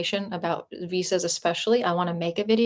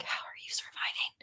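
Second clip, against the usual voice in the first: whispered aside; 13 dB quieter than usual. Retold or imitated. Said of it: "and I'm like".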